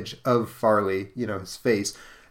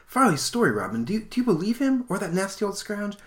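put-on much higher questioning girl voice; girl hero; 11 semitones higher than usual. Wonder Girl asks Robin whom to believe, high girl voice. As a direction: ask the leader in a high girlish voice.

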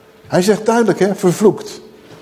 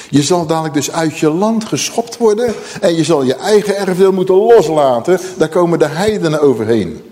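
ordinary delivery

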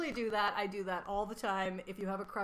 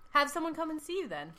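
higher, calling up to a window; shouting up to balcony; 8 semitones higher, 4 dB louder than usual. Acted. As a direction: call it up to the window above. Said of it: "Joe is downstairs yelling up at the window".

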